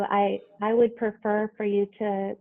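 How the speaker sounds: ordinary delivery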